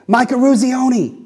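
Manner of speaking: high, loud shout of his own name; exhausted player shouting his name to the coach; yelled name. Retold or imitated. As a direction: call out your name with everything left. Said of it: "he just yells out his name".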